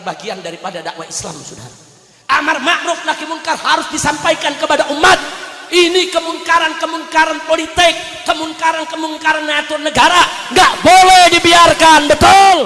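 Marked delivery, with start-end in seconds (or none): 2.4-12.6 high, very loud shouted exhortation; full-force preaching. Real, not acted. Preacher urging the crowd to oppose political wrongs, shouted preaching.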